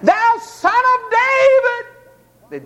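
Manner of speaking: high, slow, long-drawn cry; beggars' shout. Retold or imitated. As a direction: call out with all your voice. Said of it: "they shouted with all the voice they had".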